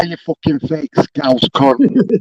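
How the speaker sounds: spelling out the insult slowly; slow drawn-out insult. Real, not acted. Calling out the rival on the panel, slow and deliberate. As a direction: lean on every word of the insult.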